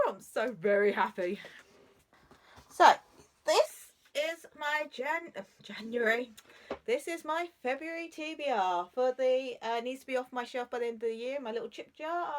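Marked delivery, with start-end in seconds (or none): none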